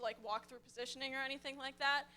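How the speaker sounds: ordinary delivery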